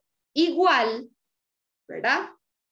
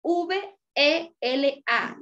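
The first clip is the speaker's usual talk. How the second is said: spelled out; 2 semitones higher, much slower than usual. Shown as a demonstration. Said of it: "la palabra vela".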